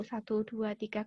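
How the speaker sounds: soft, low count to three; calm counting. Shown as a demonstration. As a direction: count quietly, as if to yourself. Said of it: "Kita hitung satu sampai sepuluh". (hitungan pelan dan rendah untuk menenangkan diri)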